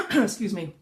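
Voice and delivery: usual speaking voice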